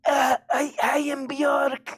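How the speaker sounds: a wobbly high [name]; [name] impression. Offering a great impression, a high weepy mock [name]. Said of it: "Would you like to hear".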